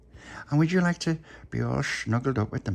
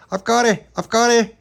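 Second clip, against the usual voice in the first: loud, high triumphant call; 13 semitones higher, 9 dB louder than usual. Acted. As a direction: shout it back from the water. Lad calling from water.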